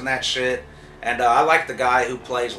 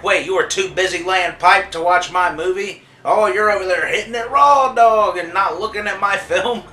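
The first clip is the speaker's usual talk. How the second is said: loud, high-pitched imitation of an indignant director; hammed-up indignant yelling; mock outraged rant; 7 semitones higher, 8 dB louder than usual. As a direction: act out his outrage, raising pitch and volume. Acts out the offended director ranting.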